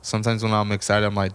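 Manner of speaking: ordinary delivery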